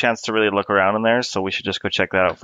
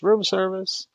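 mock shout; 10 semitones higher, much slower than usual. Player voicing Otto instead of being stealthy, mock shout. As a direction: call it out high in a pretend shout. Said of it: "Otto shouts".

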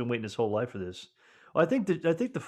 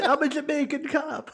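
mock Jamaican accent; slower than usual. Mimics undercover Jamaican cop.

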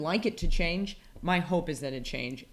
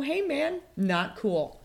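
much higher, casual voice of a chill God; mock laid-back God; 10 semitones higher, 3 dB louder, much slower than usual. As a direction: play God as a mellow dude. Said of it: "God might look at that and go".

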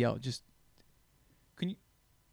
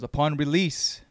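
ominous prophet; much slower than usual. Pretend.

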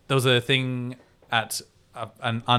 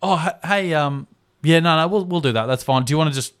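higher, slightly louder acted interjection; hesitant, higher-pitched peacemaker voice; placating staff member; 6 semitones higher, 5 dB louder than usual. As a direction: step in hesitantly to smooth things over. Acts man stepping in to placate.

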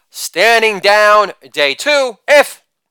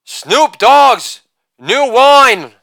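ordinary talk

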